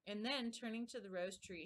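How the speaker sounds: ordinary delivery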